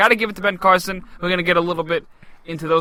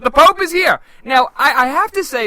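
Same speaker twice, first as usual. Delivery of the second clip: excited announcement; 6 semitones higher, 4 dB louder than usual. Real, not acted. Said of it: "I don't know why I said it in that accent".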